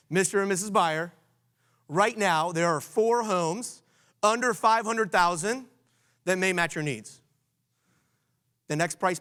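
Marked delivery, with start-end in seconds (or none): 0.1-7.2 slow, deliberate sales script read to buyers; slow scripted pitch. Shown as a demonstration. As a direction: lay out each number slowly, like a polished pitch. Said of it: "you're gonna say".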